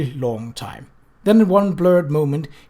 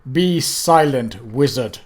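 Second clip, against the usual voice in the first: loom over them with every word; Death, cold and menacing; slower than usual. Acted. Death confronts the intruders, slow and menacing.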